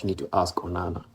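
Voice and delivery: usual speaking voice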